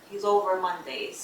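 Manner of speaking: normal talking voice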